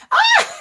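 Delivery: sudden high, loud squeal of delight; excited shriek. Real, not acted. Host greeting her friend with a delighted squeal. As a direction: shriek with joy at a friend joining. Host spots a guest arriving.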